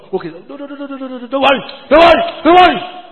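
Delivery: rapid noes building to high shouts; exasperated withdrawal. Acted. Leader hastily withdrawing the request.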